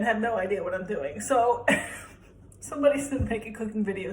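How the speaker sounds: ordinary talk, nothing marked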